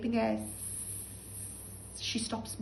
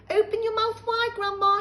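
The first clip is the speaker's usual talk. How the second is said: put-on higher, louder boy's voice; boy George; 12 semitones higher, 8 dB louder than usual. George feeding grandma medicine, higher and louder. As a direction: coax her to open up. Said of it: "he said".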